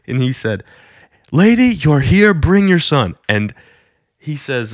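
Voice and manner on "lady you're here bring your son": calling out. Retold or imitated. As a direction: summon her from the crowd. Preacher calls woman out of crowd.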